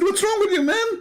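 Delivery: very high, strained imitation of exasperation; mock outraged outburst. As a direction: mimic a debater at the end of his patience. Retold opponent losing patience.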